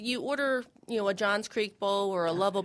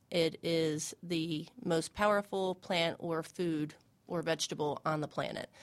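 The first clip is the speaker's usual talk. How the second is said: ordinary talk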